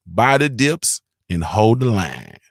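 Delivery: slow, deliberate "Buy the dips and hold the line"; slow motto. Shown as a demonstration. Repeating the viewer's motto slowly for the audience. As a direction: lay it down word by word. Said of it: "That's the recipe".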